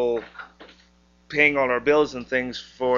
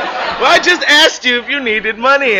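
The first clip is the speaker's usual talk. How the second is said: loud, high mock outrage; laughing, high-pitched protest; exasperated scolding; 11 semitones higher, 8 dB louder than usual. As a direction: scold him in comic indignation. Retold scolding while smacking him.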